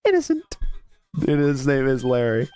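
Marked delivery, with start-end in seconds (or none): 1.1-2.5 deep voice. Acted. Same deep voice.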